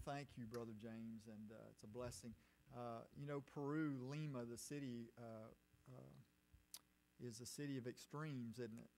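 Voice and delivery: ordinary talk, nothing marked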